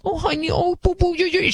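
put-on high syrupy baby talk; cooing mom. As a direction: coo at him like a doting mother. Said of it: "the mom was like".

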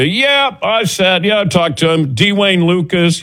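high, over-eager name-dropping; fake know-it-all. Bluffing horse-racing people about who he knows, mock know-it-all. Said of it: "I was trying to sound like I knew".

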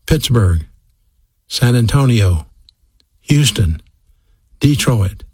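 slow reading with a pause after each city; slow, spaced-out list. Real, not acted. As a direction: read out each city separately and unhurriedly. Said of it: "I will speak slowly".

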